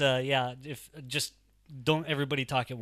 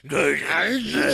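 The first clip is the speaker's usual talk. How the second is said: shouted ghoul voice, higher; 3 semitones higher, 8 dB louder than usual. Acted. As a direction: howl it like a ghoul. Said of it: "you wanna do a ghoul voice".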